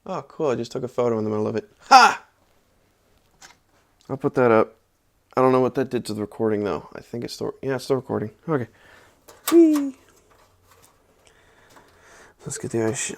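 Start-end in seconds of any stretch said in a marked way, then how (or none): 1.8-2.2 one loud high bark of laughter; sharp laugh. Real, not acted. Reacts to accidental photo. 9.5-9.9 high playful squeal; playful whee. Real, not acted. Swinging the case again.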